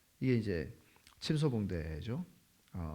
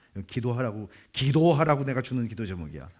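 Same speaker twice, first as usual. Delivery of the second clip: insistent gossip; 2 semitones higher, 8 dB louder than usual. Retold or imitated. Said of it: "이렇게 하고 이제 말해요".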